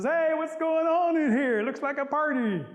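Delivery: high, loud, drawn-out holler; excited calling out. Acted. Possum walking in on the wedding party, hollering. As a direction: burst in hollering to the whole room.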